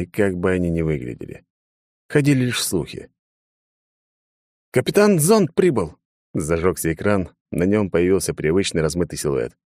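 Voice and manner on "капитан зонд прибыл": loud report. Acted. Captain reports arriving, calling out.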